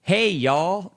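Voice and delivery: loud, high put-on Southern "Hey, y'all"; mock your sister's forced y'all; imitating sister. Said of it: "she just goes".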